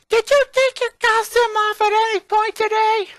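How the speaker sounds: very high, loud accusing demand; Lola's furious high-pitched voice; angry little girl. A narrator's reading. Lola storming, upset.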